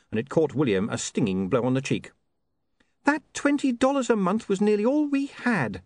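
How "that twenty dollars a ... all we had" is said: put-on high-pitched indignant young woman; high, aggrieved character voice; indignant Saccharissa. Indignant young woman confronts William.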